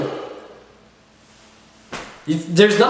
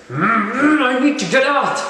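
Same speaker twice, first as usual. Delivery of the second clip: high, strained mock discomfort; hammed-up cringing groan; acted cringe; 8 semitones higher than usual. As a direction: whine it as if desperate to escape. Acting out his cringing reaction.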